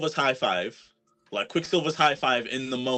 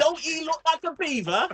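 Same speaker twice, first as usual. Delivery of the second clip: high put-on London accent; old London voice, pitched up; old school London accent; 3 dB louder than usual. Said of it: "my old school London accent".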